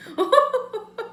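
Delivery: high-pitched laugh; bursting into a high laugh. Real, not acted. Laughing at own joke.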